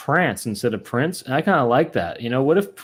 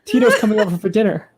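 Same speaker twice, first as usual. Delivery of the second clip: [name]; 10 semitones higher than usual. Acted. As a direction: call out in a high put-on voice. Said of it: "He goes".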